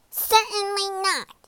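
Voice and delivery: high, cross princess retort; cross snap. A narrator's reading. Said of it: "snapped Poppy crossly".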